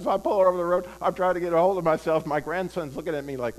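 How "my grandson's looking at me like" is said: usual speaking voice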